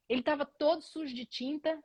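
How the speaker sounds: normal talking voice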